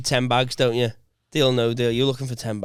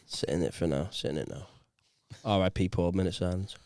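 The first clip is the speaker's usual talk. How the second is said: very low, soft tribute voice; low and subdued, as if at a memorial; mock-solemn hush; 11 semitones lower, 11 dB quieter than usual. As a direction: hush the room for a mock minute's silence. Mock minute silence for Paul.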